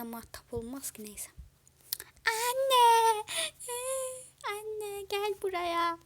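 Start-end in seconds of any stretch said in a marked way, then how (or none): 2.3-6.0 put-on high, drawn-out child's call; baby calling mother. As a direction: wail loudly for mum like a little kid. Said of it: "Annesini çağırıyor bebek ya".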